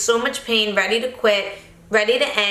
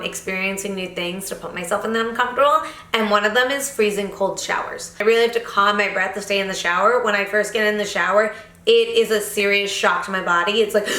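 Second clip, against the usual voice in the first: ordinary delivery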